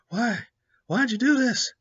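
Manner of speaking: high, loud cry of betrayal; anguished captive. Retold or imitated. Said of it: "And he's like".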